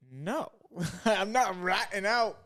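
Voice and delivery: laughing as you refuse to snitch; laughing refusal. Retold or imitated. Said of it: "I was like".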